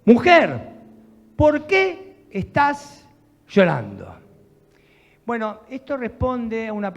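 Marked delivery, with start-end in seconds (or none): 0.1-4.2 loud, slow angelic question to the weeping woman; booming angel. Acted. Angels asking the crying Mary at the tomb, loud and slow. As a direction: call down from on high, drawn out.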